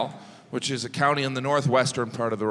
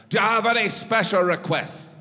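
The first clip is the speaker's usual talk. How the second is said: higher-pitched mock Irish sound guy; Irish accent; 5 semitones higher than usual. Sound guy asks the comic for special requests, Irish accent. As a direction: put on a lilting Irish accent. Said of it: "the sound guy was like".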